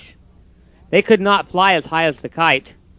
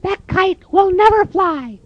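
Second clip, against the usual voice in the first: very high, squeaky, slow bird voice; mocking robins. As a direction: tease him from the bush.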